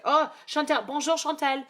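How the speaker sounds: high, loud greeting in fake French accent. Real, not acted. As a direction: greet someone brightly with a put-on French accent. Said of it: "I use my fake French accent".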